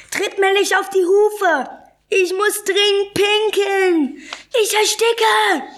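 high, stretched-out cries of protest; agitated complaint. A narrator's reading. Animals crammed into a tiny cage, crying out in distress. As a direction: cry out high and drag the words.

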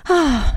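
high, falling "ah" sigh; acted sigh. A narrator's reading. Girl bored with her island, high falling sigh. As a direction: let out an audible, falling sigh. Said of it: "Die Klette seufzte".

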